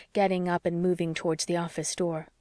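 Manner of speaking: ordinary delivery, nothing marked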